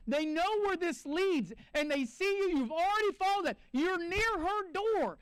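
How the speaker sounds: urgent preacher warning; rising urgency. Real, not acted. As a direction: build the alarm. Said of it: "preaching climax".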